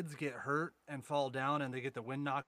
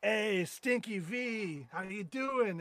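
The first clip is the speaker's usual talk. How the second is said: drawn-out greeting; 6 semitones higher, 4 dB louder, much slower than usual. Retold or imitated. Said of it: "and I'm like".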